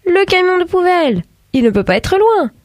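rally everyone to give chase at once; urgent. A narrator's reading. Urgent dash after the garbage truck. (exclamation urgente, aiguë)

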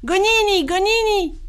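very high, falling call; sing-out summons, pitched right up. A narrator's reading. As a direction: call up to the sky by name. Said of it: "Boone Boy l'appela".

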